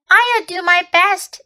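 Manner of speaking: eager schoolboy promising; young boy. A narrator's reading. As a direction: Edmund promising the queen, high and eager. Edmund voiced, boy's reply.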